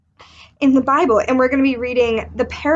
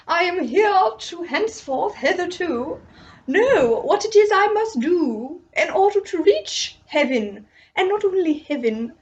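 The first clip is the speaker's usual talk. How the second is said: higher, grandiose haughty voice; pompous rich man; 5 semitones higher than usual. Acted. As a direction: play a self-important rich man.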